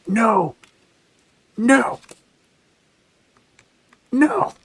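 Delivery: rising, escalating yells of no; shouting no. Real, not acted. Streamer reacts to losing.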